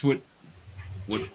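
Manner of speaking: ordinary delivery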